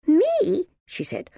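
put-on very high, startled little girl; indignant child. A narrator's reading. Izzy answers the minder's accusation, indignant. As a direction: protest at a high pitch, loudly.

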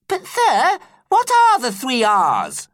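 a worried pupil pleading for an answer; anxious question. A narrator's reading. Lucasta anxiously asking her teacher in a very high girl's voice. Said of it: "asked Lucasta anxiously".

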